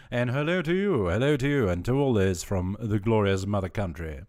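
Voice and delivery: mock-grand greeting. Streamer greeting a UK viewer from the colonies, mock-grand. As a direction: proclaim it with playful pomp.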